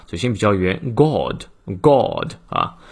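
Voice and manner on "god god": God with rounded British short o; British pronunciation. Shown as a demonstration. English teacher showing students British God, rounded o vowel. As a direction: round the lips on God, drawn out slowly. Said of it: "而英音的时候呢，嘴型比较圆".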